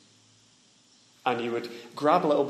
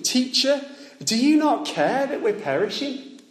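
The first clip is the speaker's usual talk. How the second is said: panicked plea; 11 semitones higher than usual. Acted. Disciples wake Jesus in storm.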